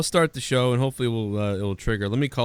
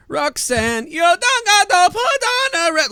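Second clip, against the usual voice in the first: put-on Jamaican accent; 3 dB louder than usual. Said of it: "he would just do this Jamaican accent".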